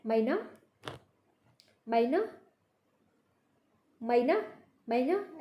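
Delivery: मैना repeated slowly with an open "ai" glide; careful model. Shown as a demonstration. Teacher repeats मैना for pupils to copy, careful and clear. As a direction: say मैना again and again for practice, open vowel. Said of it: "मैना जो है इसको बोलने कैसे जाएगा?".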